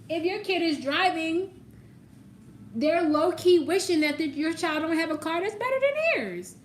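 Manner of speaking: high-pitched, loud mock dramatics; exaggerated high delivery. Reader teasing about a jealous parent's wish, high and exaggerated. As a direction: push it up high and loud, hammed-up.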